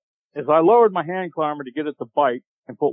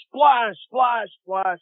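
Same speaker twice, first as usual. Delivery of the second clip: mimicked splashes; 8 semitones higher, much slower than usual. Imitating footsteps in water.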